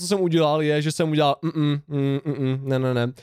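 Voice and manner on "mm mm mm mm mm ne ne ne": mumbled refusal. Retold or imitated. Acting out his mumbled refusal.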